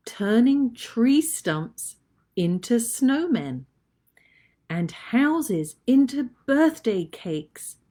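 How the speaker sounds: put-on slow, lively poem for kids; poem reading. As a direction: read the poem with wonder. Reads winter poem aloud.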